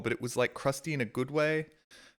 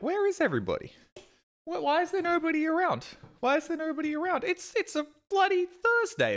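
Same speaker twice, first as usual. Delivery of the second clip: much higher, puzzled squeak; baffled disbelief. Retold or imitated. Streamer retelling the closed cafe, high baffled disbelief. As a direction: act out total confusion at the empty street.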